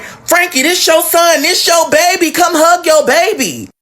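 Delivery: high, insistent aunt voice; pushy aunt. Retold or imitated. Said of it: "she's like".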